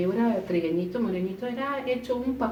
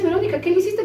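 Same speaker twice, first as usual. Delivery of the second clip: high, loud startled demand; imitating a friend's panicked question; alarmed accusation; 11 semitones higher, 7 dB louder, faster than usual. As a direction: fire the question in sudden alarm. Friends accusing each other in fright.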